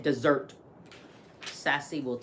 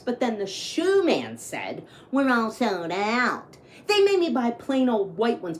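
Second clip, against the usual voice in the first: lively read-aloud storytelling; story narration; 5 semitones higher, 6 dB louder than usual. A narrator's reading. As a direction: read it like a picture book to kids. Reads picture book aloud.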